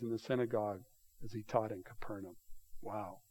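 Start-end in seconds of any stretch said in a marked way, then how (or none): none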